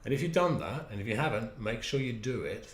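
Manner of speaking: ordinary talk, nothing marked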